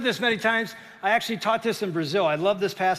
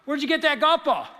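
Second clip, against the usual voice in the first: loud, high coach's challenge; calling out; 8 semitones higher, 6 dB louder than usual. Retold or imitated. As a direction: call him out across the room. Coach confronts player in story.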